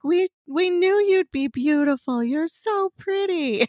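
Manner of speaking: put-on high, drawn-out girlish gush. Two young girls behind the counter greeting a customer, imitated high gushing.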